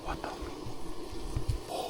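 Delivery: slow, trailing whisper; whispering in fear at another print; scared whisper. Real, not acted. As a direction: barely breathe it out, cut off. Terrified, staring at second print.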